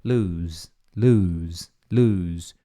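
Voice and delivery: "Lose" looz said low, repeated; low repeated drill of "lose"; repeated model. Shown as a demonstration. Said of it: "e um Z no final".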